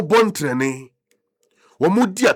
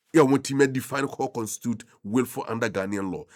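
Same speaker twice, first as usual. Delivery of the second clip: normal talking voice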